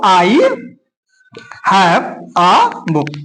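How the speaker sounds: very slow, word by word; drawn-out classroom dictation. Shown as a demonstration. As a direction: leave a long gap between the words. Writing out the English translation.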